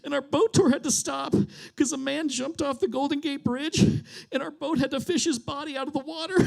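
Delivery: high-pitched tearful wail; hammed-up weeping tourist; mock crying. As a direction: imitate a woman sobbing out her story. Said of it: "immediately starts crying, and she goes".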